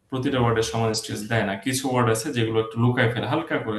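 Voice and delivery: normal talking voice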